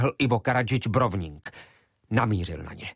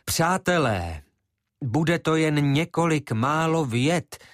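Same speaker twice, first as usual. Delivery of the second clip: called out; 6 semitones higher, slower than usual. Acted. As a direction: appeal to a roaring crowd.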